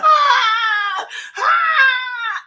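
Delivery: high, loud hammed-up sobbing wail; mock crying wail. Mother retelling her breakdown to her kids, laughing mock wail. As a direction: wail it like you're falling apart, half laughing. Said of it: "And I'm just crying".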